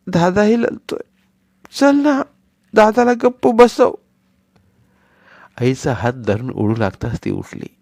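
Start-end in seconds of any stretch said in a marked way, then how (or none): 0.1-3.9 put-on high, lisping little boy tugging at his brother; begging childish whine; little boy coaxing his crying brother; pleading small child.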